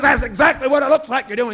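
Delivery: loud, high indignant retort; furious king answering Jack; angry king. Acted. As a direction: fume at the nerve of it. King catches Jack kissing.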